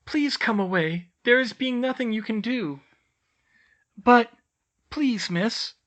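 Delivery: high elf voice, a hint of pleading; urging elf. A narrator's reading. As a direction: coax her away. Tilly urges Hermione away.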